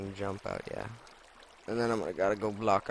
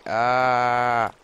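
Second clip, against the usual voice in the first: startled scream; 10 dB louder than usual. Real, not acted. Startled by sudden danger.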